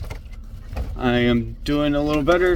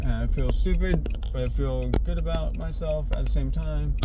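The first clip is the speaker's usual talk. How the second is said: ordinary talk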